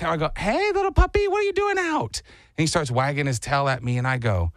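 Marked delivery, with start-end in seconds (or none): 0.4-2.2 put-on high sing-song baby voice for a puppy; high puppy talk. Narrator cooing to a lost puppy, high baby talk. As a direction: coo up high at the little dog. Talking sweetly to stray puppy.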